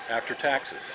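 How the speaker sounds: ordinary delivery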